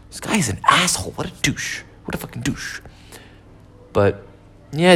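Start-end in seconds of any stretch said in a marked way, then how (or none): 0.1-2.8 whispered aside, under the breath; muttered insult. Retold or imitated. Speaker mocking the sulking neighbor, in a whispered mutter. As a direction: hiss the insult quietly to yourself.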